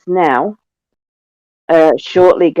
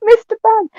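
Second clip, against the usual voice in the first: high, excited cheer; much slower than usual. Real, not acted. Player cheering arena appearing.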